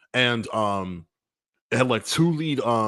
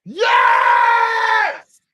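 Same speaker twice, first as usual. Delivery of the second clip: a huge, much higher, louder yell of joy; real triumphant scream; ecstatic shout; 9 dB louder than usual. Real, not acted. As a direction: cheer like your favourite team just scored. Cheering after a high score.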